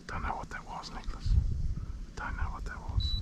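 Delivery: hushed whisper so as not to scare the fish. Real, not acted. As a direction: breathe the words out, no voice. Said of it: "Why are you whispering?".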